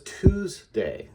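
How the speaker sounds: Tuesday slowly as "TOOS ... day"; long oo in Tuesday, then a separate day; deliberate two-part Tuesday, read off the spelling; sounded out by syllable. Shown as a demonstration. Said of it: "O O really gives you the vowel sound".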